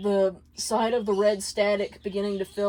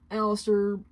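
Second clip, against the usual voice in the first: plain reading voice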